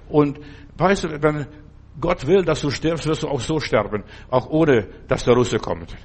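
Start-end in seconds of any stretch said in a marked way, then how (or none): none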